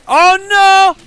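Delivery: high, loud ad-style cry; accidental commercial announcer; loud, high comic exclamation. Real, not acted. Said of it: "sounded like the damn Old Spice commercial".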